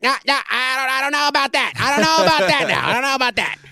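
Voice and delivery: impersonating the director with mock doubt; Dave the director impression. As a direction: do Dave brushing it off. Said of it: "That's my best Dave, uh, the director impression".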